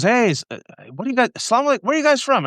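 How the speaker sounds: a cheerful local calling out from his cab, pitched high; friendly cab driver. Retold or imitated.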